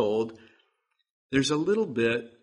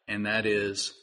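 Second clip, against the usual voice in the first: usual speaking voice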